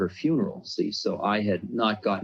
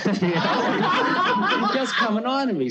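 laughing; 3 dB louder, slower than usual. Real, not acted. Laughs at the guru's teasing.

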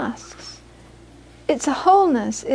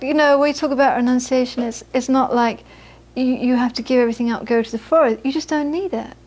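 ordinary delivery

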